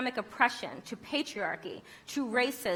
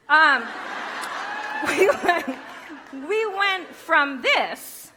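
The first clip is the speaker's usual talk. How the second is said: laughing; 5 semitones higher, 7 dB louder, much slower than usual. Real, not acted. Laughs at the slide.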